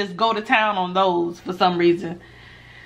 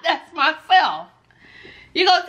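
laughing; 7 semitones higher than usual. Real, not acted. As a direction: chuckle it out, amused. Laughing at her own point.

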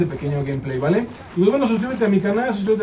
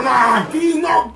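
high, slow yell of disbelief; frustrated shout; 7 semitones higher, 3 dB louder, slower than usual. Real, not acted. Gamer yelling at the screen when ambushed. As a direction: shout it out as you get caught.

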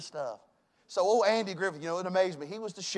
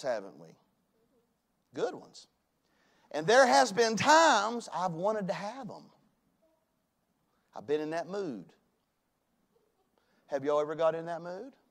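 ordinary delivery

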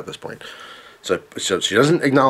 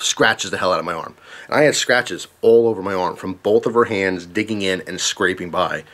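usual speaking voice

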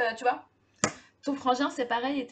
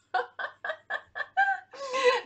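high-pitched helpless laughter; laughing fit; 12 semitones higher than usual. Real, not acted. Streamer cracking up over her rusty English, high laughter. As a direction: burst into a long, high laugh.

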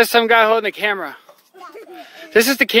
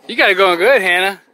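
ordinary delivery

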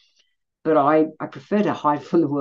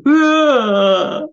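loud, high shocked groan of disgust; a loud appalled ugh; shocked gasp; 10 semitones higher, 8 dB louder than usual. Retold or imitated. Attendee behind her, loudly shocked groan. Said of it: "the sound was like".

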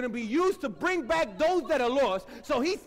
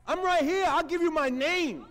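a hint of a proud angel, lightly raised; 4 semitones higher than usual. Angel to Zechariah, mildly proud and raised. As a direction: angel presenting himself with a touch of swagger. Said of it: "It's like saying".

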